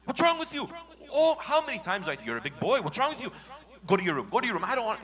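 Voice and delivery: high, loud scolding yell; furious parent. Acted. Angry father yelling at child over bed accident. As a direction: shout at the child, go to your room.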